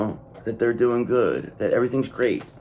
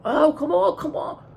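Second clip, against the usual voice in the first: mock pleading. Mocking the counterpart's pleading.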